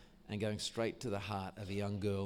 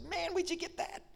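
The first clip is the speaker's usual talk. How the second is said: surprised. Retold or imitated. Surprised at old school cassette.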